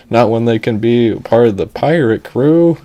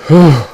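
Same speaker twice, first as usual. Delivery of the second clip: loud, high puff of mock exhaustion; exhausted whew; 6 semitones higher, 9 dB louder than usual. Storyteller worn out by the tale, exaggerated whew. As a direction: blow out a big theatrical whew. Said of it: "very exhausting tale indeed".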